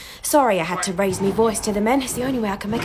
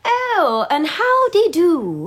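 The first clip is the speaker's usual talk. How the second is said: hammed-up genteel guest, higher and slow; mock party guest; 4 semitones higher, 3 dB louder, slower than usual. Maid mimicking party guests for her mistress, hammed-up genteel voice. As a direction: send up the posh guests with a lilting drawl. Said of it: "They'll all talk like this".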